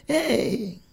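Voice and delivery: ordinary talk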